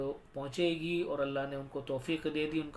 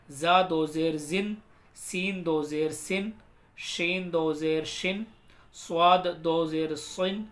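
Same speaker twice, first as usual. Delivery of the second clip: slow, measured sing-song drill; spelling recitation; 4 dB louder, slower than usual. Shown as a demonstration. Teacher leading learners through do zer, measured recitation. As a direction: chant each letter, then its sound.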